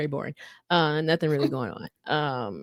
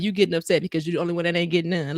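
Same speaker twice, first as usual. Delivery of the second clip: rapid scolding; faster than usual. Retold or imitated. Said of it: "but she was like".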